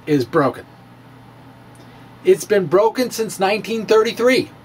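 ordinary talk

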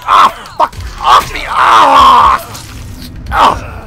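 loud, high cries of struggle; real panicked scream. Real, not acted. Player screaming while a zombie attacks, loud panic. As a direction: scream as it pins you down. Grabbed again by enemy.

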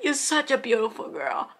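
high, breaking voice, quoting through real tears; tearful quote. Retold or imitated. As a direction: pass on the line while choking up. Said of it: "Babette was like".

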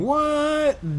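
high, drawn-out "what"; amazed, rising far above normal pitch; surprised. Real, not acted. Surprised at a viewer from Copenhagen.

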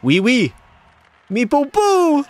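high, silly mock French accent. Streamer proving his French voice to chat, silly high French. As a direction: ham up a goofy Frenchman. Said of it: "I thought I sounded more, uh, French".

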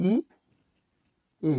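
ই, then এ, each sounded separately with a pause; split vowel pronunciation. Shown as a demonstration. Teacher breaks a compound letter into ই and এ, sounded separately. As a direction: sound out the two vowels ই and এ one by one. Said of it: "এই বর্ণের উচ্চারণ".

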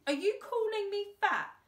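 put-on a touchy henchman bristling at the boy; growled. A narrator's reading. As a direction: take offence loudly. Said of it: "growled Thumbs".

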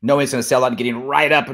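normal talking voice

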